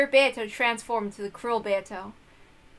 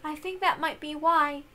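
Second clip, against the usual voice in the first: put-on higher, softening girl's voice fading to sad; sad young girl; 7 semitones higher than usual. Young Beato confides in her servant, higher and sadly fading.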